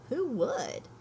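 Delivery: plain reading voice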